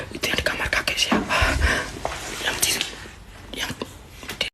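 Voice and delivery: very soft, voiceless whisper; frightened whisper. Real, not acted. Asking who sits at the dressing table, frightened whisper. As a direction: whisper so the figure won't hear. Whispering at figure in grandfather's room.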